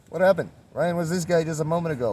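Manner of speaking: high, loud startled bystander; mock surprise. Mock shocked friend, wondering where Ryan went. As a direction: act out a friend's shock at the switch.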